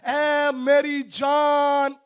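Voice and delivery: long-drawn, ringing call to one's own soul; fervent gratitude. Acted. Preacher voices David addressing his soul, fervent and drawn out. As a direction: stretch it out slowly, full of thanks. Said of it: "इसी शुक्रगुजारी के जज्बे से भरा हुआ".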